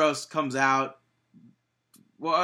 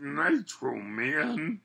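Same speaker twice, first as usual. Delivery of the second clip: Macho Man voice; 3 dB quieter, slower than usual. Shown as a demonstration. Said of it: "you just have to talk like".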